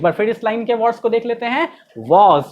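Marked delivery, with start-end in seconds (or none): 2.0-2.4 वॉज as one distinct "woz"; read-along model. Shown as a demonstration. Teacher leading class read-along, distinct word. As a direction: say वॉज alone for repetition. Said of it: "पढ़िए मेरे साथ".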